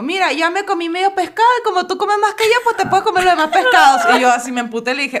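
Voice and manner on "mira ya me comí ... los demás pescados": high, run-on mimicry; imitating Venezuelan roommate. Retelling the roommate's fish offer, high mimicking voice. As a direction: pitch it up and rattle on like the roommate. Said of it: "me dijo".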